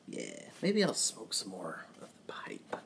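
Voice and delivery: whispered aside to himself; a hushed thought about the pipe. Real, not acted. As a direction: murmur it under your breath, unvoiced.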